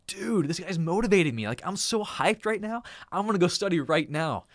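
bursting with excitement at a motivating video; hyped up. Retold or imitated. Retelling getting hyped by video.

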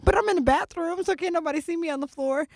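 high-pitched weepy self-defense; mock tearful whine. Telling her sister nobody can see her crying, mock tearful whine.

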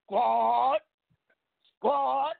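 loud rising call of the crew name. Real, not acted. Host hypes his squad on air with a loud call. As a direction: shout it out to the squad like a rallying cry.

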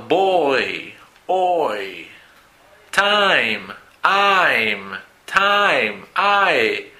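put-on word list from boy to chair, each glide drawn out and falling; diphthong demo. Shown as a demonstration. Teacher models two mouth positions from boy to Chair, diphthong demo. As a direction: stretch both vowel positions in boy, Time, Road, Down, Beard, Chair, loudly. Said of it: "diptongos que tienen dos posiciones".

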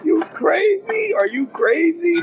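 high, slow incredulous you crazy; hammed-up shock; mock outrage.

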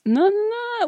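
squeaky high no no no; mock denial. Pretending officials deny touching balls, squeaky. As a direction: deny it way too fast.

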